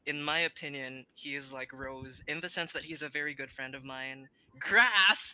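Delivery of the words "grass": much louder, higher shout; loud exclamation. Real, not acted. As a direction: blurt it out at full volume. Blurts out the given word.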